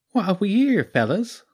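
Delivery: a sly lounging weasel with a rural Sussex lilt; high-pitched sneaky creature with a country twang. Acted. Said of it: "Its voice was high, with a little Sussex countryside twang".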